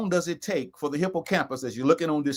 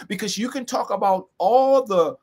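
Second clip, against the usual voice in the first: ordinary delivery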